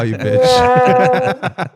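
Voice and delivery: loud, high cry through laughter; real laughing exclamation; laughing outburst. Real, not acted. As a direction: crack up while cursing your co-host. Cracking up mid-rant.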